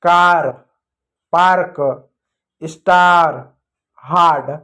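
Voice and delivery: कार, पार्क, स्टार, हार्ड one by one, आ held long; long आ sound. Shown as a demonstration. Teacher recapping for children, loud long आ in कार, पार्क, स्टार, हार्ड. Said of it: "इसलिए हमने आ पढ़ा".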